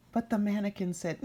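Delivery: plain reading voice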